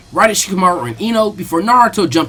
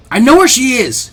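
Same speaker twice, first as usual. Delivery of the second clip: higher, louder eager yell; 7 semitones higher, 6 dB louder than usual. A narrator's reading. Naruto shouting to his sensei while running off, loud. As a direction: call it out as you run. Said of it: "Naruto yelled".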